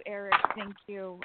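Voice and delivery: ordinary talk, nothing marked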